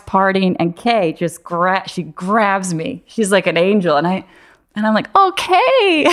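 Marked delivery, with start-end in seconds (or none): 5.2-6.1 very high, loud giggly squeal; giddy laughing. Retold or imitated. Concussed woman greets her friend Kay, laughing giddily. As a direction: act out being giddily out of it. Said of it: "I was just laughing".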